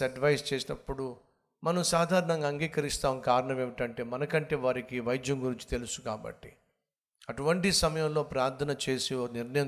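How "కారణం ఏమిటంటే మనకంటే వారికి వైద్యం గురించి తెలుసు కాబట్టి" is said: ordinary talk, nothing marked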